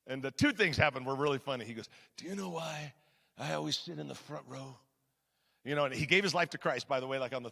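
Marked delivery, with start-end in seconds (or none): 2.2-4.8 hushed mobster impression; Glenn. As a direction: softly play an intimidating Godfather type. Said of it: "he talks like this".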